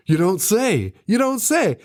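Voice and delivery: hammed-up phone-call acting; one-sided phone call. Radish on the phone to a police officer, hammed-up reaction. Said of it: "Radish talking to a police officer".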